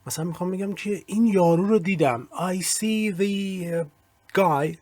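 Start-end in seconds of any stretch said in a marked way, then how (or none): 2.3-4.8 "the" in "I see the guy" stretched out as "thee", then a pause; stress on "the". Shown as a demonstration. Teacher showing how to emphasise the, "the" drawn out as "thee". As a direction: hold a long "thee" before "guy", very slowly.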